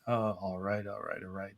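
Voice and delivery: a hint of Matthew McConaughey, low and lazy; McConaughey drawl. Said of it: "in the voice of Matthew McConaughey".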